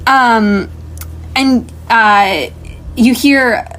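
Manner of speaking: ordinary talk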